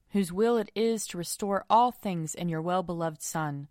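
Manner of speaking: usual speaking voice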